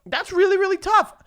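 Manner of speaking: high and loud, really worked up; excited. Real, not acted. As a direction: sell how tough it is. Excited debate.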